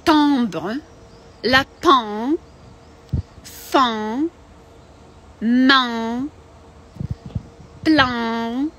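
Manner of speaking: "Timbre", "lapin", "fin", "main", "plein" each said slowly through the nose; French nasal vowels. Shown as a demonstration. Teacher drills nasal French words for the class. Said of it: "третій носовий «en»".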